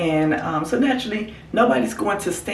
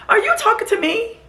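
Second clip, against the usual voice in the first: much higher, indignant mock question; imitating indignant lady. The woman on the phone challenging the driver, high and indignant. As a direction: play the offended businesswoman. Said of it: "She's like".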